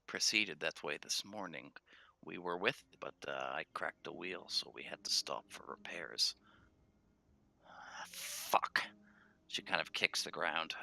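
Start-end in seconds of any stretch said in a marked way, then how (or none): 0.1-6.3 soft, lowered explaining; quiet worried halfling. Acted. Halfling leader confiding her stranded caravan, hushed and worried. 7.7-8.8 muttered curse. Acted. Halfling curses frustrated.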